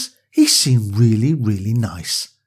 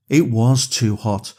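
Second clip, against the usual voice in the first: ordinary delivery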